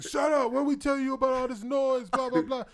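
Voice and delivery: loud, high mock scolding; retold exasperated shout; angry cop shouting. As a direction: imitate a fed-up cop yelling. Retold cop scolding noisy prisoners.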